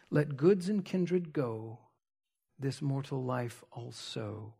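slow, soft, low hymn lines; hushed, measured poem reading; solemn verse recitation. Real, not acted. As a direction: recite reverently with long pauses, fading to a hush.

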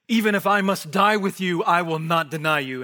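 loud, insistent declaration as Peter; emphatic vow. Retold or imitated. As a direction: swear it out loud, chest forward. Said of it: "he emphatically said".

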